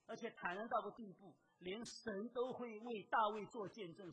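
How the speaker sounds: ordinary talk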